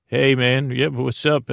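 normal talking voice

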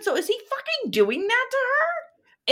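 appalled, voice shooting high; outraged disbelief. Real, not acted. Outraged at the husband's gesture, pitch jumping high in disbelief. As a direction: pitch leaps way up in shock.

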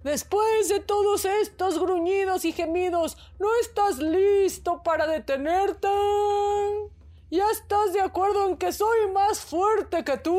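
high, loud, slow call; tortoise calling out. A narrator's reading. Hidden tortoise shouts teasingly to the elephant. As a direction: shout across the jungle, teasing. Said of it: "la tortuga gritó".

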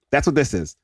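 ordinary delivery

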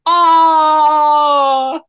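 high cutesy squeal; cooing over it. Real, not acted. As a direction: gush over something adorable. Cooing over a cute picture.

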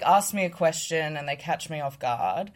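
ordinary talk, nothing marked

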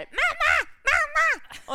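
high, loud imitated child scream; screaming kid. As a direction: mimic a child shrieking for mom. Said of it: "också skriker".

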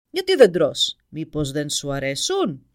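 booming, slow giant voice; ogre roar. A narrator's reading. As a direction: roar like a hungry dragon. Dragon questions prince. (βροντερή φωνή δράκου που βρυχάται)

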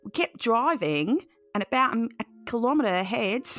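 put-on cheeky copy of her accent; mock Australian accent. Host mimicking the guest's story, mock Australian accent. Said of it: "I'm trying to do it now".

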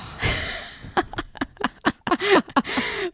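airy high-pitched giggle; breathy high giggle. Real, not acted. Giggling to her friend after a naughty confession.

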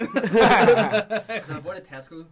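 slow, laughing through it; giggling while he speaks. Real, not acted. Laughing while telling the story.

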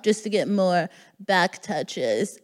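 ordinary talk

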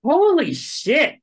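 high, loud, drawn-out shock; real amazement. Real, not acted. Player reacting to a huge roll, slow loud amazement. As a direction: stretch it out, floored.

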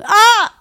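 sudden loud high shriek; disgusted shriek. Real, not acted. Recalling the flasher, a loud high shriek.

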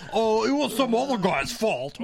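high, put-on rock frontman voice. Band caught out blaming someone else, hammed-up rock-singer impression. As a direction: whine the excuse like a metal singer. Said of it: "that's my best Metallica impression".

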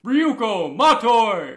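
booming, drawn-out fighting-game announcer; Smash announcer voice. Acted. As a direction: call the fighter's name like an arena announcer. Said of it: "do a fucking Smash announcer voice".

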